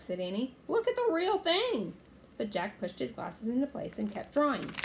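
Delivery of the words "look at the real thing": put-on high, insistent kid's plea; eager, raised voice; urging. A narrator's reading. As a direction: push him to look, pitched right up. Annie urging her brother.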